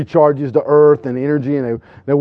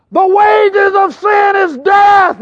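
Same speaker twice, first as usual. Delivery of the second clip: very high, very slow bellowed verse; street preacher shout; 5 dB louder, much slower than usual. Retold or imitated. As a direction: thunder the verse at the crowd.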